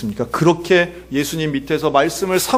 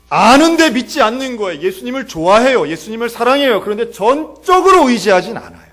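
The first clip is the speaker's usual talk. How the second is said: loud, high, urgent sermon delivery; full-force preaching; 7 semitones higher, 6 dB louder than usual. Real, not acted. Preacher confronts believers' lack of trust, full-force preaching. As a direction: drive each point home with raised pitch.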